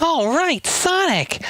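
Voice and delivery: very high boyish cartoon voice; Tails voice. Sidekick cheering on his hero, very high. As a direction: chirp it like a young fox kid. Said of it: "Four Kids Tails".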